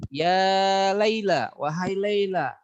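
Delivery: drawn-out slow call of Ya Laila. Shown as a demonstration. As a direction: call her name across the room. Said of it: "huruf untuk memanggil".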